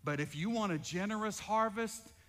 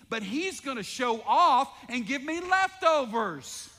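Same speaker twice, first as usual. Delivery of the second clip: higher and louder, preacher rising; full-force preaching; 6 semitones higher, 6 dB louder than usual. Acted. Pastor firing up the congregation, preaching higher and louder.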